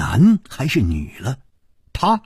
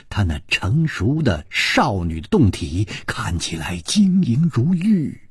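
ordinary delivery, nothing marked

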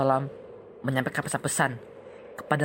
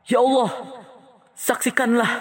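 calling out; 9 semitones higher, 4 dB louder than usual. Acted. Prophet calls on God.

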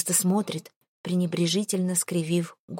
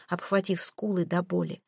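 usual speaking voice

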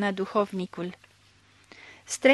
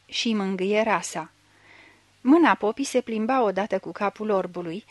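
ordinary delivery, nothing marked

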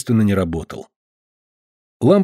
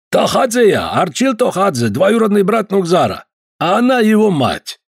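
put-on Caucasian accent; 9 semitones higher than usual. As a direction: stretch each word with a heavy Caucasian accent. Georgian visitor voiced.

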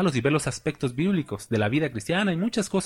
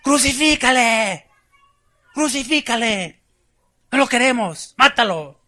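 higher, louder, slow mob shouting; angry mob; 10 semitones higher, 6 dB louder than usual. Retold or imitated. Preacher acting the mob demanding crucifixion, angry shouting. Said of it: "Unos días después".

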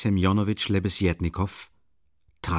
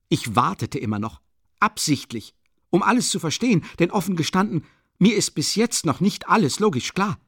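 high, loud indignant tirade; 7 semitones higher, 3 dB louder than usual. Acted. Young witness denouncing the swindler, rising indignation. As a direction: climb higher as the outrage builds. Heated accusation scene.

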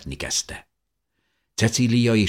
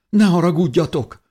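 high, loud apologetic call; calling out apologetically; 14 semitones higher, 7 dB louder than usual. A narrator's reading. Woman by the fire apologizes to the others, calling out. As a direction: call an apology across the cave.

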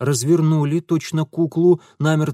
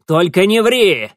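loud, high angry bark; barked order; 5 semitones higher, 8 dB louder than usual. Acted. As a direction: strain and bellow the warning. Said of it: "гаркнул". (злобный окрик)

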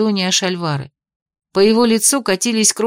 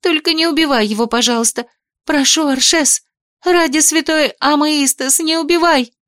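high, pleading mock plea; exclaiming; 7 semitones higher than usual. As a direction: cry out, begging, pitch high. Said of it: "воскликнула я".

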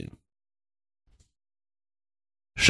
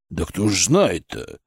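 low, slow, glum reply; gloomy remark; 7 semitones lower, 4 dB quieter, slower than usual. A narrator's reading. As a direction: grumble it with understated gloom. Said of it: "хмуро заметил Шаповалов".

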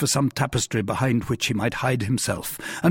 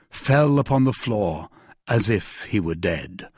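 plain reading voice

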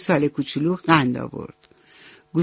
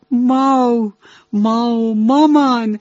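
higher, louder happy calf, slowing on بهتری; overjoyed calf; 5 semitones higher, 5 dB louder than usual. A narrator's reading. Calf leaps into mother cow's arms, overjoyed, higher and louder. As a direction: gush with joy into mother's arms. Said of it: "پرید توی بغلش و گفت".

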